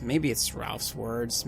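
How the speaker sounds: ordinary talk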